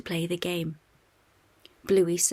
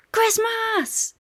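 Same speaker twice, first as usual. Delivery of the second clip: high, loud shout of "Christmas!"; yelling; 5 dB louder than usual. A narrator's reading. Bluey as Santa waking the family, yelling. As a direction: shout it to wake everyone. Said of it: "Bluey yells".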